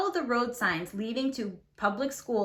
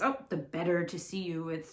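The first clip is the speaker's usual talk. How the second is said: lower, softer croaky wolf voice; raspy voice; 5 semitones lower, 6 dB quieter than usual. A narrator's reading. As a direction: growl it low and soft from under the covers. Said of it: "said the raspy voice".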